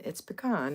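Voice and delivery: "pecan" as puh-KAHN, weight on the second syllable; quick puh, open KAHN for "pecan". Shown as a demonstration. Correcting the meme, "pecan" as puh-KAHN. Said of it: "the way I was raised saying it".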